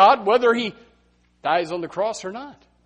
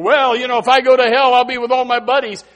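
flippant; 4 semitones higher, 7 dB louder, faster than usual. Retold or imitated. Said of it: "I've heard people flippantly say before".

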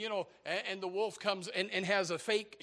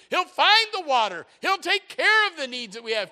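high, loud praise from the pulpit; full-force preaching; 10 semitones higher, 12 dB louder than usual. Real, not acted. Preacher praising God for the shepherd of dumb sheep, full-force preaching. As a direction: build into ringing, insistent phrases.

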